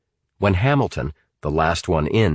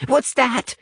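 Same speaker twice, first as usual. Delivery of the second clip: high, sudden startled cry; startled exclamation. A narrator's reading. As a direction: jump and cry out. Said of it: "exclaimed Natalie".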